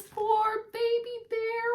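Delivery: mock pity for Baby Bear. A narrator's reading. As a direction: coo it with sad sympathy. Narrator pities Baby Bear.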